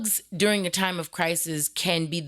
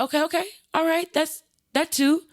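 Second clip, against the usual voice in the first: much higher, anxious soothing; calm him down while scared inside; nervous placating; 12 semitones higher than usual. Retold or imitated. Staff placates the angry boy in a much higher, nervous voice. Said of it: "I'm like".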